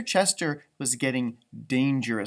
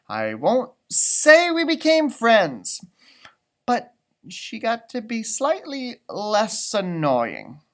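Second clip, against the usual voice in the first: higher, slow, reluctant storytelling; grudging leprechaun narrator; 7 semitones higher, 6 dB louder, slower than usual. A narrator's reading. Mackenzie narrating how he grudgingly warmed to Lucky. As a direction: admit it through gritted teeth.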